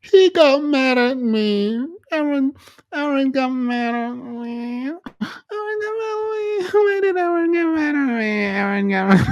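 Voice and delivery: high fake-sobbing whine with laughing breaks; mock whiny crybaby. Mocking the guy who defended Aaron, high fake whining and sobbing.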